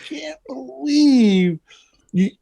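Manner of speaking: high, slow scolding disbelief; mock disbelief. Comic telling Otto off about the puppet, high slow disbelief. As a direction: drag it out scandalised. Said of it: "I'm going".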